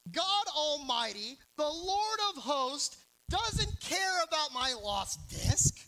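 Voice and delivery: slow booming mock protest; mock indignant rant. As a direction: proclaim it like an offended man. Mock outrage at wife's suggestion.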